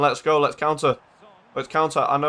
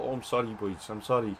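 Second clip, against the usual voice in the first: soft, low apology in a badly faked Portuguese accent; Jota's Portuguese accent; 4 semitones lower, 10 dB quieter than usual. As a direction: murmur sorry with a poor Portuguese accent. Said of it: "in his little Portuguese accent".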